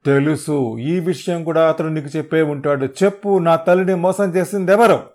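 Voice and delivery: loud, climbing to a high yell; rising fury. Acted. Hero holding the thug by the neck, rising furious demand. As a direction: demand the name in rage.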